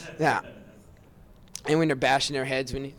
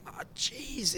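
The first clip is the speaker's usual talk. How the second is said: breathless whispered oh jeez; whispered aside; 8 semitones higher, 5 dB quieter than usual. Retold or imitated. Friend quoting a horrified knight, whispered. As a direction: hush it out in shock.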